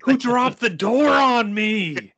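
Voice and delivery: high, slow, drawn-out bellow; mock outraged yell. Co-host acts the creature under the door, outraged howl.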